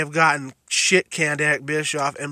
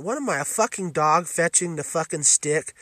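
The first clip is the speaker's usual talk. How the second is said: usual speaking voice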